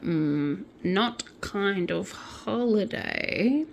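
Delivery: slow, hesitant doubt; dubious. Acted. Nicole doubting the prize holiday, drawn-out dubious tone. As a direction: draw it out, unconvinced. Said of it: "like, dubious. She was like".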